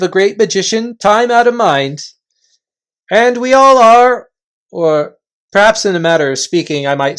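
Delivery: loud, high chieftain calling a halt; gruff invisible chief. Acted. Invisible chief halting the Narnians, loud and high. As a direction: bark the warning from thin air.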